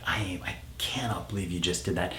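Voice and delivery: hushed, breathless whisper of shock. Retold or imitated. Dad to son after his misdeed, whispering. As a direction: whisper it as if too upset to speak aloud.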